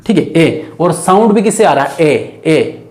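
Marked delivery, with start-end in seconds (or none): 2.1-2.9 "ए" as a lone open vowel, tongue and lips still; open vowel demo. Shown as a demonstration. Teacher shows students where the sound comes from, low open vowel "ए". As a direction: softly sound "ए" twice, lower, without moving the tongue. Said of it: "बिना जबान होठ हिलाए यहाँ पर sound निकलेगा".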